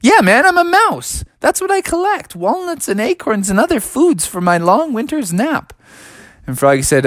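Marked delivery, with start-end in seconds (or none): 0.0-5.7 very high squeaky mouse; small creature voice, pitched way up; little friendly mouse. Acted. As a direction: chatter defensively in a tiny high voice. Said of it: "And the mouse said".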